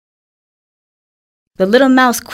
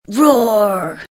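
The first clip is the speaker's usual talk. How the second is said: drawn-out spoken "roar", falling; storybook roar at talking volume; mock lion roar. A narrator's reading. Trapped lion calls out, mild mock roar. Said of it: "He roared very loudly".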